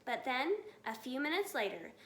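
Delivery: ordinary delivery, nothing marked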